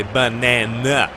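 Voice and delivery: banana with a short flat a, bə-NAN-uh; the American banana, middle a like in cat. Shown as a demonstration. Contrasting American banana for viewers, flat a. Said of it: "They say banana".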